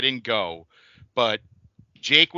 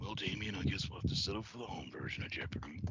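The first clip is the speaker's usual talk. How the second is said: whispered, much lower Jake line; Jake Roberts impression; 8 semitones lower, 16 dB quieter than usual. Shown as a demonstration. Jake taunting Alex Trebek with Damien, whispered. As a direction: whisper it low like Jake the Snake. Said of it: "and then he says".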